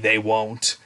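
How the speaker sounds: low, quiet verdict; grim slayer. Retold or imitated. Goblin Slayer answering her plea, imitated grim finality. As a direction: drop low and soft, leaving no argument.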